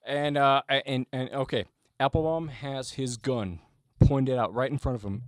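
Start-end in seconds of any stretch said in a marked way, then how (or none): none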